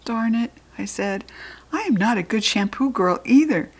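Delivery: ordinary delivery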